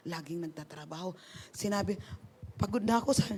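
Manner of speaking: ordinary talk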